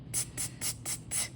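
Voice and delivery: breathy unvoiced t, t, t; isolated t sounds. Shown as a demonstration. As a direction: tap out the t sound softly. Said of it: "Let's spell tick first".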